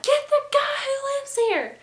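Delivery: very high, loud yell; shouted demand. Retold or imitated. Roommate demanding the tenant from balcony, high screamed order.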